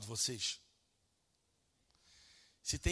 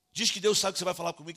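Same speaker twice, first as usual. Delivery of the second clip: louder, higher indignant reproach; retold scolding with raised voice; irate father; 4 semitones higher, 10 dB louder, faster than usual. Father throwing son's excuse back at him, irate. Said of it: "eu tava irado com ele".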